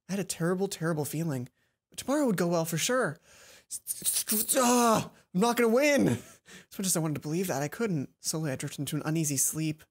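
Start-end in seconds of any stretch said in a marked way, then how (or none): 4.6-6.1 loud despair. Real, not acted. Player despairs about the tournament.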